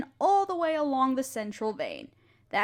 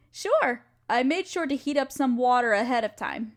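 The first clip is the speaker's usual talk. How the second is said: usual speaking voice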